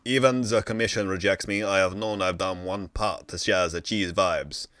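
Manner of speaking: put-on French-London accent building to a loud cry; muddled French-London accent. Final words to the show's hosts, muddled French-London accent. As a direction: declaim it in a muddled French accent, swelling at the end. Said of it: "maybe work on the accent".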